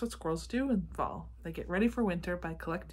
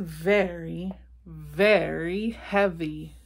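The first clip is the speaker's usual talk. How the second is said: "very, very" drawn out slowly and loud; heavy emphasis; 5 dB louder, much slower than usual. A narrator's reading. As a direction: lean hard and slow on each "very". Stressing the wagon's great weight.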